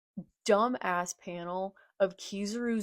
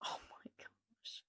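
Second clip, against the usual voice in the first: breathless whisper; 18 dB quieter than usual. Real, not acted. Overwhelmed reaction.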